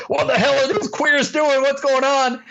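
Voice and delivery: lightly voiced baffled crew guys griping; gruff camera guys. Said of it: "all these gruff union camera guys are like".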